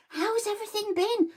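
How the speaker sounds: high, bursting-in greeting; bubbly tutor greeting. Retold or imitated. Tutor bursting in to greet Dexter, bubbly and high. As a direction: burst in like a bottle of pop. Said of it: "She explodes in each day".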